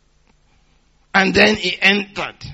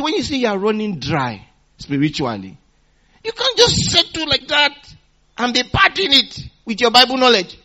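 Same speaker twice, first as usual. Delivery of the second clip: normal talking voice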